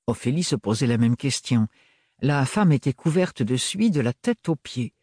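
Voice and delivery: plain reading voice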